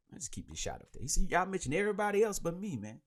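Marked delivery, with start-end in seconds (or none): 1.3-3.0 high, quick joking protest; mock complaint. Host repeating the comedian's joke about being snubbed, mock complaint. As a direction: kid around as if you feel left out. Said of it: "He was joking".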